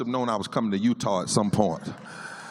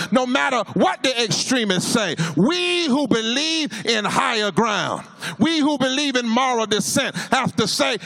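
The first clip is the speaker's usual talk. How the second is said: rising, chanted sermon refrain; preaching at full force; 9 semitones higher than usual. Acted. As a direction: build the crowd with each "snake line". Sermon climax.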